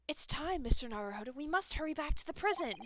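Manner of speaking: lightly voiced young girl; a hint of an eager assistant; character voice.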